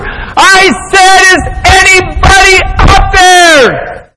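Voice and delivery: high, full-throated desperate holler. Acted. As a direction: shout it up again, each word stretched. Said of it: "So even louder".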